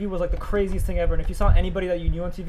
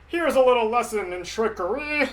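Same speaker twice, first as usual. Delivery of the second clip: slow, hammed-up villain quote; mock villain; 3 semitones higher than usual. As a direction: draw it out like a showy cartoon schemer. Quotes We Are Number One song.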